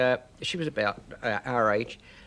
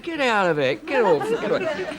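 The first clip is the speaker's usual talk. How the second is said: higher, laughing mock shoo; kids shooing her off; 6 semitones higher than usual. Kids shooing away the posh girl, laughing. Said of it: "We said".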